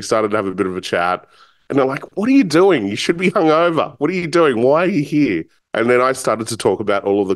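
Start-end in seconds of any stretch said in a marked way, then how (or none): 2.2-5.4 higher-pitched retold bafflement; baffled questioning. Table regulars quizzing the young newcomer, baffled. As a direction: imitate an incredulous group firing questions.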